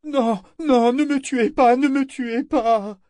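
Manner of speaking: very high, panicked begging; stammered pleading. A narrator's reading. Governor begs for his life, high frightened babble. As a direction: beg in a high voice, dragging the last plea out. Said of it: "bredouillait-il".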